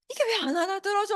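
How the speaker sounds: high, loud, worked-up protest; imitating someone getting heated; excited outburst. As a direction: blurt it out in frustrated disbelief. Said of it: "하면서 막 흥분하잖아요".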